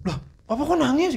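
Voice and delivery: lightly voiced child's question, pitched high; imitated puzzled nephew. Nephew asking his father why he cries, imitated puzzlement. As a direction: retell a puzzled kid asking, pitch lifted. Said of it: "gua cerita sama ponakan gua".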